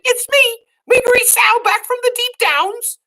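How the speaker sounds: put-on high, loud girlish shout, as Meg. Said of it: "she shouted".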